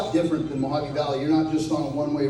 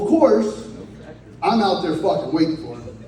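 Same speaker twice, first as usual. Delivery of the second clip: normal talking voice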